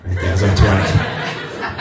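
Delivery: a lazy teasing trainer voice; low, slow mock trainer. Trainer mocking a client's tiny calorie burn, low and slow. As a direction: drop way down and drag it out, laughing.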